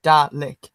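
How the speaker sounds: "Dalek" said as "Talek", the D hardened to a T; accent demo. Shown as a demonstration. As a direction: say "Dalek" with a T sound at the start, "Talek". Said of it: "it just sounds like".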